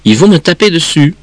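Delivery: high, lightly acted child's worry; frightened child. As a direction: plead your case like a scared kid. Frightened child explains to mother.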